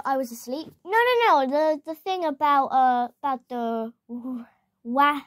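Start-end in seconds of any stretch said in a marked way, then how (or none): none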